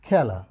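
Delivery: "খেলা" pronounced khæla, open æ vowel; standard pronunciation demo. Shown as a demonstration. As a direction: say "খেলা" with the open æ, unlike its spelling. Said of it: "তখন আমরা উচ্চারণে কিভাবে লিখি".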